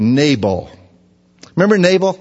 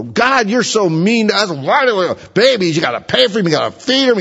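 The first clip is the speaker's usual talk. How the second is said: much higher whiny complaint to God; mock whining; 7 semitones higher than usual. Couple complaining to God about a baby, mock whine. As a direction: grumble like a spoiled couple. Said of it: "they both start and go".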